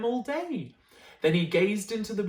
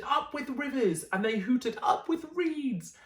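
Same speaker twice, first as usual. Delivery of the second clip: happy hoot; 6 semitones higher than usual. Acted.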